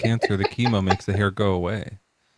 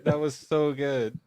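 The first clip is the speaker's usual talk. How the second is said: laughing; 4 semitones higher, 4 dB quieter, slower than usual. Real, not acted. Still laughing at the joke.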